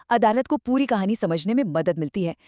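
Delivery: ordinary delivery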